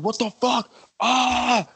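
high panicked yell, then a raw cry; retold scream. Reliving the crash for friends, high panicked scream. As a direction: yell in terror as the car flies. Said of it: "I was screaming".

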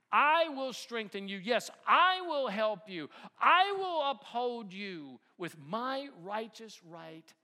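loud, ringing scripture proclamation; booming pulpit declaration; forceful preaching. Acted. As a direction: proclaim God's promise with full force.

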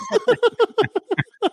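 a very high burst of laughing; high cackle. Real, not acted. Laughing after the clap prank punchline, high cackle.

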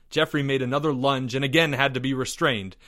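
ordinary delivery, nothing marked

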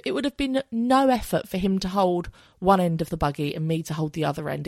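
ordinary talk

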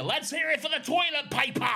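high, loud pirate-like American yell; failed American accent, shouted like a pirate; yelled bad American accent. Shown as a demonstration. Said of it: "I just sounded like a fucking pirate".

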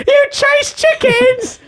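falsetto taunt, slow, through laughter; high laughing taunt. Acted. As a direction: squeal it out laughing.

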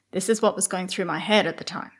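ordinary talk, nothing marked

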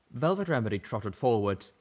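ordinary delivery

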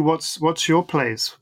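ordinary talk, nothing marked

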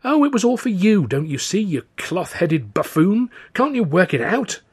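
high, loud exasperated outburst; cross. Acted. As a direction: scold someone too dense to understand. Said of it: "Whatever are you so cross about".

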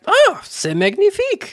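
put-on high, hammy French chef accent. A sudden French exclamation.

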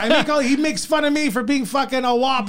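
mock-exasperated self-defense; indignant protest. Retells protesting to girlfriend's apology.

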